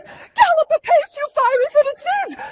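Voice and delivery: very high, loud, urgent cry; hammed-up shrieked impatience; impatient Juliet. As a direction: burst out with frantic longing. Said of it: "She cannot wait for Romeo".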